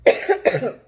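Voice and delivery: loud, high rattling cough. Shown as a demonstration. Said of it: "that's how that rattle".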